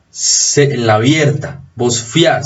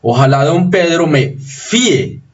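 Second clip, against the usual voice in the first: fíe in two syllables, accent on the í; stress on the Í of fíe; 3 semitones higher than usual. Shown as a demonstration. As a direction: lean hard on the first vowel of "fíe". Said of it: "Acento en la cerrada".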